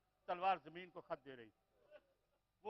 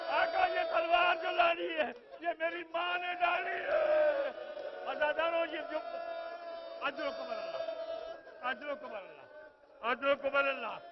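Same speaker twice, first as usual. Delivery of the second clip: loud, raised preacher's wail; full-force majlis wailing; preaching lament; 8 semitones higher, 10 dB louder than usual. Acted. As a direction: mourn it aloud to the gathering.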